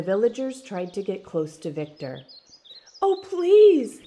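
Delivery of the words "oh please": high, loud pleading wail; mother's desperate high cry; frantic cry. A narrator's reading. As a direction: beg in terror for your child. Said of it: "his mother cried".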